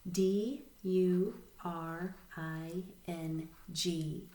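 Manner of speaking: slow, letter-by-letter spelling; spelling aloud. Shown as a demonstration. Teacher leading learners in read, spell, read, spelling aloud. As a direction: spell it out slowly, a gap after each letter. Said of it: "read, spell, read with me".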